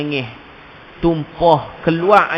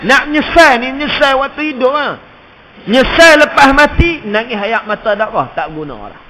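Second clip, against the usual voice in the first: loud, high sermon cry of regret; impassioned Malay sermon voice; fiery preaching; 8 semitones higher, 7 dB louder than usual. Acted. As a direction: preach it with rising urgency. Preaching at full force.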